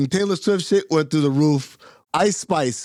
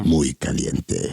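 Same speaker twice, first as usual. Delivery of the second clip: low, slow Spanish accent; 11 semitones lower, much slower than usual. Shown as a demonstration. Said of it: "should I have said it like this?".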